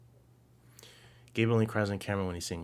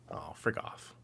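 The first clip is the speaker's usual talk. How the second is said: "Oh, frig off" breathed out under the breath; a quiet, airless brush-off; muttered quote; 4 semitones lower, 10 dB quieter than usual. Retold or imitated.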